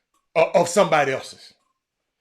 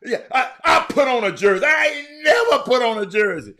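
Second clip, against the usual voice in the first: loud, high-pitched indignant rant; pissed off; 4 semitones higher, 4 dB louder than usual. Real, not acted. Host ranting about the guest who provoked him, worked up and indignant. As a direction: protest loudly at being pushed into this. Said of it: "He just pissed me off".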